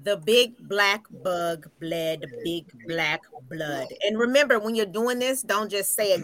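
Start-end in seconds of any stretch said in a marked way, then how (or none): 0.0-3.9 slow and deliberate, mouth stretched; slow articulated. Shown as a demonstration. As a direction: articulate every word slowly. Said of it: "You're really stretching and articulating your mouth".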